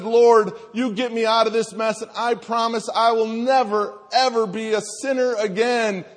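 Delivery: high, loud frantic bargaining; desperate pleading. Acted. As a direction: beg for rescue at full voice. Hanging man bargains with God.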